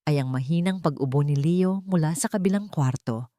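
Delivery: usual speaking voice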